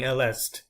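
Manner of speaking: "elle est" with the end letters sounded; wrong pronunciation. Shown as a demonstration. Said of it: "pronouncing these letters at the end".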